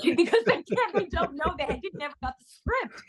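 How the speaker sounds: high-pitched, laughing delivery; helpless amusement, pitch riding up; laughing while talking. Real, not acted. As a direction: crack up through the words. Laughs while telling the credits joke.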